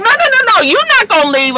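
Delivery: high, insistent pleading no. Retold or imitated. Tammy begging Rashida to stay, high insistent. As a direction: stop her from leaving. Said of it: "Tammy was just like".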